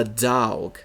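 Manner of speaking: "dog" stretched into a slow dawg; dawg spelling. Shown as a demonstration. Reading vendors' product names, "dog" drawled as dawg. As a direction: draw out "dog" with a long aw. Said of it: "with an A W G".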